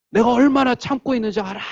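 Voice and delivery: high, loud outburst in a sibling fight; retold angry shout. Brother in a fight with his sister, imitated angry shout. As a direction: yell it at full pitch, imitating a fed-up child. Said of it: "막 소리를 지르고".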